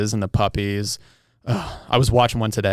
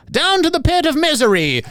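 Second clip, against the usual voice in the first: much higher, louder mock-medieval proclamation; medieval herald; 3 dB louder than usual. Quoting a favorite beer ad line to co-host, hammed-up herald cry. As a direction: bellow it like a hammy king's herald. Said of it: "my favorite line from those commercials is".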